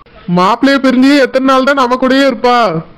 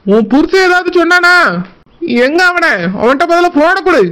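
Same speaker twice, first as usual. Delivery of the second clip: higher, urgent demands from an angry brother; angry protective brother; 5 semitones higher than usual. Acted. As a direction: demand the phone, ready to fight for her. Brother demands what husband did.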